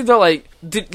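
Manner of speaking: ordinary talk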